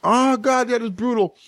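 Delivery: high, pained wasabi burn; mouth burning from spicy food. Real, not acted. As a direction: gasp through the burning heat. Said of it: "I'm asking for trouble 'cause I eat these".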